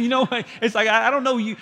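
ordinary talk, nothing marked

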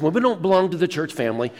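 ordinary talk

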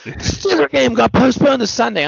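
high-pitched wail of a football fan; mock screaming. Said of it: "I saw a guy screaming".

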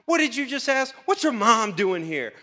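high, loud shocked disbelief; indignant shock. Retold or imitated. Disciples shocked at the mother's request, high pitched. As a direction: gasp it out in outrage. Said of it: "they're just like".

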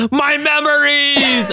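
high, long-drawn mock scream of anguish; hammed-up scream. Logan grabbing his head as host retells it, hammed-up scream. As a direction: wail it slowly and melodramatically. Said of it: "He screams".

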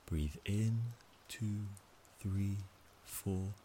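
calm, measured count with pauses; slow breath counting. Shown as a demonstration. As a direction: count each breath slowly and evenly. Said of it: "It goes like this".

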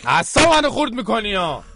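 hammed-up grumpy host; mock irritable. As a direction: put on a fake short temper.

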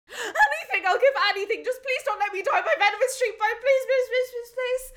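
high, loud frantic begging; desperate begging. Acted. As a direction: beg for your life. King begs.

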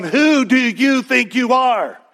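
high, slow, scornful "Who do you think you are?"; affronted leaders mocking Jesus, high and slow; indignant challenge. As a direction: throw it at him like an accusation. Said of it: "You gotta get the tone".

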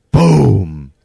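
loud, falling boom; imitated wall pounding. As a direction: boom it out like a fist hitting a wall.

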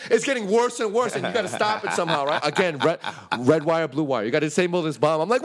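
ordinary talk

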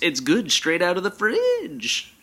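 lazy whining. Retold or imitated. Acting her lazy self.

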